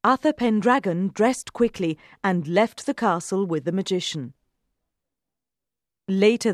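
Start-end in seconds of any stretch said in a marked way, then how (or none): none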